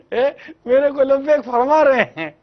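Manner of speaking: delighted wife. Retold or imitated. Wife amazed her husband answered her call, giddy and high. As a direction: squeal with astonished joy.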